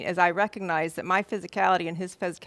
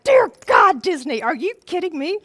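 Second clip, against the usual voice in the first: very high, loud squeal of disbelief; astonished cry; 11 semitones higher, 10 dB louder than usual. Retold or imitated. Her inner cry at the piano, high, loud and stunned. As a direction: shriek it out, overwhelmed and amazed. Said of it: "and I was like".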